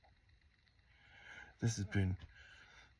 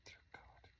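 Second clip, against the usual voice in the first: a hushed, breathy mutter; whispered aside; 18 dB quieter than usual. Real, not acted. Alone at night, whispering to himself, hushed. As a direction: barely breathe it out, softer.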